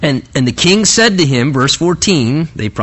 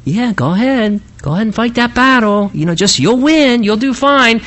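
high-pitched breezy false encouragement; sarcastic; 7 semitones higher than usual. Acted. Prophet tells the king to go fight, sarcastic. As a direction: wave him off with mock approval. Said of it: "There had to have been some kind of sarcasm".

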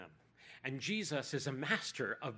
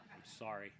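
ordinary talk, nothing marked

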